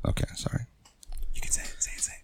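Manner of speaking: breathy whispered apology, barely voiced. Real, not acted. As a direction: drop to a whisper, a little sheepish. Hushed apology mid-banter.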